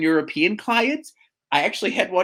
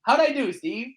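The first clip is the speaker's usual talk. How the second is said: calling to husband; 9 semitones higher than usual. Real, not acted. Calls to husband off mic.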